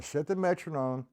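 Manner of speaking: normal talking voice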